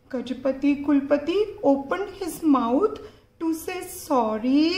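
usual speaking voice

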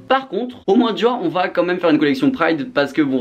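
high-pitched put-on corporate voice; mocking brand voice. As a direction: mimic opportunistic brands in a high voice. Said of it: "Les marques vont se servir de lutte".

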